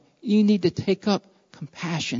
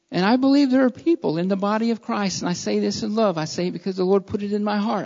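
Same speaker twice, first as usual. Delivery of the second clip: normal talking voice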